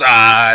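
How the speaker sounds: loud sudden "Ah" to make people jump; mock scare shout. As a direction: burst in with a scare yell. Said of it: "pops in, and goes".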